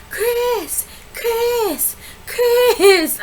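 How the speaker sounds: high mock wail of his name; mock pleading calls. Wife calling after her departing husband, high mock wail. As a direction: call him back like she's desperate.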